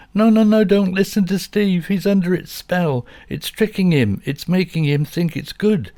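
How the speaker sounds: high, loud frantic dog pleading; a little dog begging, high and urgent. A narrator's reading. Dog Bobby warns that Steve is under a spell, high and frantic.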